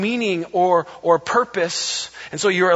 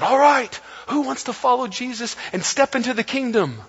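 upbeat pitch; 6 semitones higher than usual. Pretend. Imagined preacher making the pitch.

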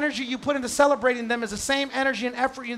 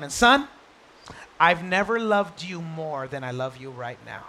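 tender father; 7 semitones lower than usual. Retold or imitated. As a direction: hold the boy gently with your voice. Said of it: "Then he says to him".

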